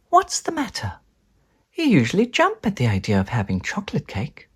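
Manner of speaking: high then hushed, puzzled mum. Acted. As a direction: wonder why he's unkeen. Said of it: "she says".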